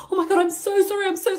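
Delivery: high-pitched imitation of a distressed apology; mock crying. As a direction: plead tearfully in a put-on high voice. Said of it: "she's crying".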